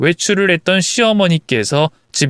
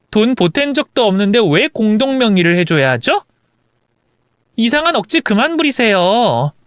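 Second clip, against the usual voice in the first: lightly acted cutting retort; scornful wife; 6 semitones higher than usual. Wife rejecting mother-in-law's joint ownership demand, scornful. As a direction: dismiss her demand as nonsense.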